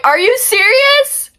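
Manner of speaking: squealing, slow are you serious; disbelief. Retold or imitated. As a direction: ask it like you truly can't believe it. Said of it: "I was freaking out".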